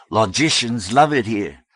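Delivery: loud, high pulpit voice; fiery preaching. A narrator's reading. Reverend Amy preaching to the hall, fiery. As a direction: ring it out to a crowd.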